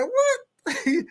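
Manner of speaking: very high-pitched incredulous "what"; comic high yelp of surprise; mock disbelief. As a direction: replay your bafflement way up high. Retelling his shocked reaction.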